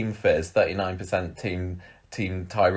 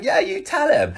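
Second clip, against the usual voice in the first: high-pitched egging her on; mock cheering on. Acting out cheering on Fizz.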